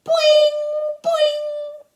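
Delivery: high, loud, springy boings; teasing yells. Acted. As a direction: yell it like springs bouncing. Children yelling while pulling curls.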